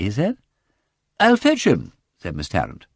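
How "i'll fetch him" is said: high, quick helpful voice; bright eager offer. Acted. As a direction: Miss Tarrant offering to fetch Goyle, eager and high. Miss Tarrant offering.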